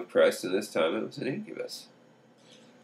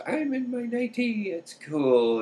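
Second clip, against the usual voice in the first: mock lady voice; slower than usual. Lady phones, relieved and happy.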